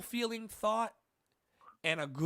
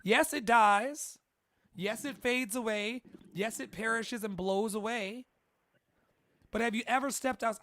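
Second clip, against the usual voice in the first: televangelist preaching; 4 semitones higher, 4 dB louder than usual. Acted. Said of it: "I know I sound like a televangelist".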